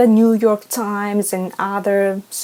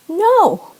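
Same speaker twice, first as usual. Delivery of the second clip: sharp, high, louder "No"; emphatic no; 14 semitones higher, 5 dB louder than usual. Real, not acted. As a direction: slam the answer down. Answering her own rhetorical question.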